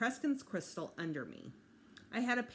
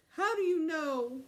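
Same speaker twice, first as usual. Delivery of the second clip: put-on whiny child; 10 semitones higher, 6 dB louder, slower than usual. Child wails at Mom.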